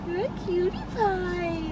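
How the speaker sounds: high, slow cooing. Real, not acted. Cooing at puppy.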